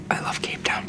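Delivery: soft, whispered secret; confiding whisper. Pretend. Confiding punchline to child's message.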